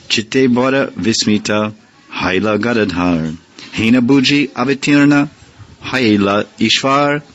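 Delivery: slow, intoned Bengali verse; verse recitation. Acted. Teacher intoning the verse for the students, slow. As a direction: chant it slowly.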